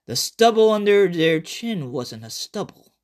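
plain reading voice

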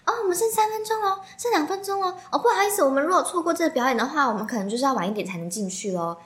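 high sing-song staff voice calling out the minutes; imitating staff announcement. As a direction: mimic the usher's high, chirpy countdown. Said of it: "那个工作人员一直这边说". (模仿工作人员高音提醒)

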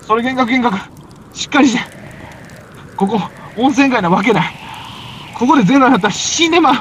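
high, loud alarmed yelling; acted panic, shouting at a friend who sees things; panicked shout. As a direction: snap him out of the hallucination. Partner hallucinating, he shouts alarm.

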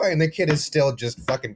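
ordinary talk, nothing marked

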